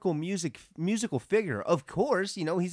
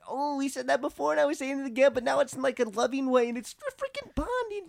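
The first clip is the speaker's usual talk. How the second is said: high-pitched excited rush; giddy, high-voiced gushing; 12 semitones higher, 3 dB louder than usual. Real, not acted. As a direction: gush in a high fangirl squeal. Gushing about the characters bonding.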